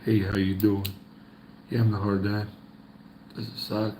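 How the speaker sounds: ordinary talk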